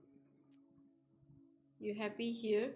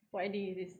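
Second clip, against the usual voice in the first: usual speaking voice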